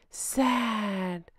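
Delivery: soft, quiet, droopy mock-sad sigh of a word. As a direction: sell the fake sorrow, softly. Mocking her staged sadness.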